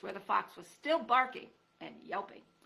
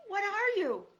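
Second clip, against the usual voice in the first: high, loud, panicky; scared mouse stumbling over words; frightened mouse; 13 semitones higher, 8 dB louder, slower than usual. Acted. Terrified mouse asks.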